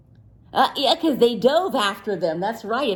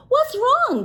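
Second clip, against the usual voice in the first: high, gentle concerned question; caring keeper checking on Sammy; kind zookeeper. Acted. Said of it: "said Mister Johnson".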